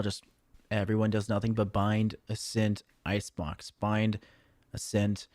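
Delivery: low, soft, bored listing of maps; deadpan bit. Acted. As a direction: drone the map list like a tired joke. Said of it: "trying to do a bit".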